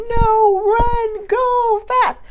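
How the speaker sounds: very high, held-out mock scream. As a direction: scream it as an inner alarm, drawn out. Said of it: "If it's screaming".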